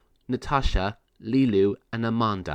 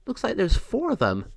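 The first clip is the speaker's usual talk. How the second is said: fairy voice; 9 semitones higher than usual. Acted. Fairy Amanda counts kittens.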